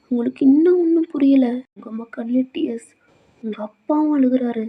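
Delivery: ordinary delivery, nothing marked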